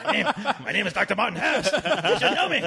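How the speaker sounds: hammed-up insistent hero; mock Liam Neeson hero. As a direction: insist on who you are through laughter. Liam Neeson bit continues.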